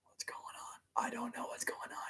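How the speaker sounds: hushed, whispered confusion; confused whisper. Real, not acted. Reader lost in the plot, whispering. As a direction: whisper it to yourself, bewildered.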